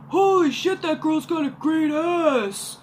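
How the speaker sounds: mock the leering date; crude date. Mimicking her date ogling a girl.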